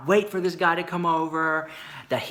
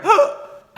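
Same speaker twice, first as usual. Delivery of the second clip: sharp loud gasp; high shocked gasp; 8 dB louder than usual. Retold or imitated. Hiding behind curtains, loud shocked gasp.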